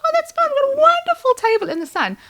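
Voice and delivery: very high, airy mock-cheerful voice; breezy retold reply. Oblivious queue-jumper answering the waiter, breezy and high. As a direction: sing out a blithe, high-pitched reply.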